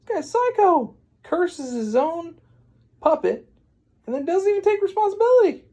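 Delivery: high, loud, incredulous rant; outraged rant. Acted. Storyteller condemning Geppetto as psycho, high outraged rant. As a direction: squeal the outrage at top pitch.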